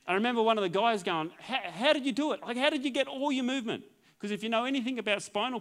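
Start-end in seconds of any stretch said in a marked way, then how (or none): 1.4-3.8 amazed physio. Retold or imitated. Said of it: "they were absolutely amazed".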